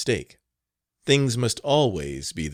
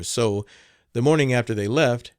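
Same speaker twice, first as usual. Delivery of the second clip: ordinary delivery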